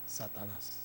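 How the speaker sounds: hushed reveal. Real, not acted. Preacher revealing who the death god was, hushed. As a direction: drop very low and soft.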